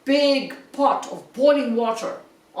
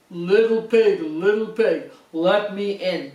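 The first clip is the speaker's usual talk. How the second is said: louder wolf voice calling out; big bad wolf; 3 semitones lower, 4 dB louder than usual. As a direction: call through the door as the wolf. Wolf calls at the door.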